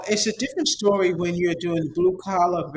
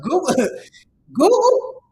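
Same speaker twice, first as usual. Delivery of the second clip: laughing, higher and slower; 9 semitones higher, 4 dB louder, slower than usual. Real, not acted. Vlogger praising Google to followers, laughing. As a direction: relish the name through a laugh.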